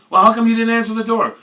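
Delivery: high, loud demand; accusing bandmate. Retold or imitated. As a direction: fire the question at him.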